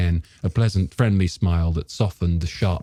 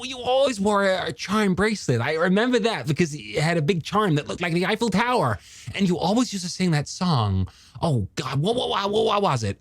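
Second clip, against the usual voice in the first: high, tumbling, eager voice; excited young man; 12 semitones higher, 3 dB quieter than usual. Acted. Young man proving he remembers her, high excited character voice. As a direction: stammer eagerly through a rush of memories, pitched up.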